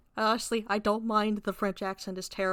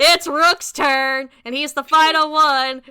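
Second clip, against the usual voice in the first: much louder and slow, stretched out; dramatic drawn-out announcement; 5 semitones higher, 12 dB louder, slower than usual. Real, not acted. Game master calling the next turn, loud and stretched. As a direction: boom it like a game-show host.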